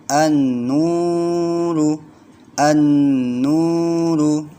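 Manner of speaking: an-nuru with the nn held two beats, slow; held recitation. Shown as a demonstration. Teacher models reading for the class, held chant. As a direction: chant an-nuru holding the double n. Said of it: "ditahan dua ketukan".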